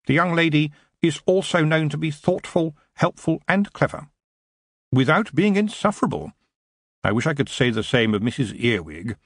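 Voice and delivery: measured praise from a careful secretary; a hint of a respectful aide; deferential secretary. Voices Drumknot, the secretary.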